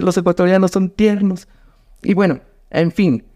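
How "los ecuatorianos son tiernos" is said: lightly high, cooing tease; mock-tender tease. A Mexican comic telling the Ecuadorian crowd they're cute, lightly cooing. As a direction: tease the audience sweetly. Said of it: "Qué tierno".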